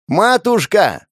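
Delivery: high, slow, loud bellow; silly loud shout; yelling in a silly voice. Acted. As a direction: bawl it at a deaf old woman. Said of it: "прокричал Жуков дурным голосом".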